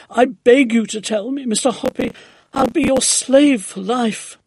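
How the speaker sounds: high, loud begging; desperate pleading cry. A narrator's reading. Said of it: "cried Missus Silver".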